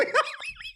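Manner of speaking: squeaky high burst of laughter. Real, not acted. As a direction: let out a shrill disbelieving laugh. Laughing at bad bar.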